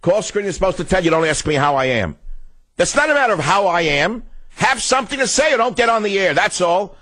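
snapping at the caller who asked how he is; lost temper. Real, not acted. Host losing his temper at a caller, high and sharp. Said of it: "I'm sorry to lose my temper".

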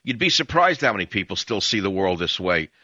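normal talking voice

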